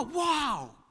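really mean it, full of amazement; a shouted wow of real awe; big excited wow. Shown as a demonstration. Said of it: "Not just like".

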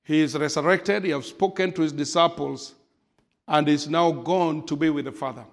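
normal talking voice

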